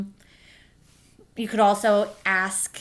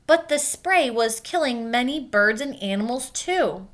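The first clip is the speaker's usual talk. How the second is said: gentle read-aloud storytelling; clear, even picture-book narration; reading to children. A narrator's reading. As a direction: read it like story time for kids. Teacher reads picture book aloud.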